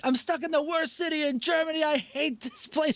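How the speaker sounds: high-pitched mock German-accented rant. German guy hating being stuck in Frankfurt, German-accented rant. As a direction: rant as an exasperated German, drawing words out. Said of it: "in this German accent".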